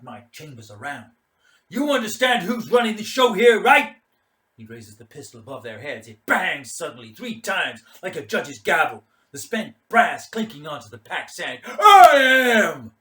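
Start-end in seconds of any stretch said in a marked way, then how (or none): 1.7-4.0 loud, high bullying challenge; threatening shout. Acted. Mad Mike asserting control over Keen, threatening shout. 11.6-13.0 loud, high roar; triumphant bellow. Acted. Mad Mike declaring himself in charge, triumphant bellow. Commander after firing shots.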